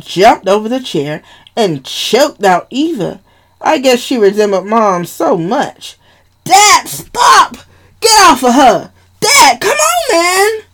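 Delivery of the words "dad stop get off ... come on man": high, loud, cracking shout to stop him; panicked yelling. Acted. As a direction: scream at Dad to let her go. Pulling Dad off choking Eva.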